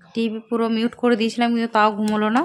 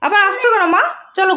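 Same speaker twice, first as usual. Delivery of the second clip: loud high call; 9 semitones higher, 5 dB louder than usual. Real, not acted. Calling to toddler coming back. (ফিরে আসা মেয়েকে উঁচু জোরালো ডাক)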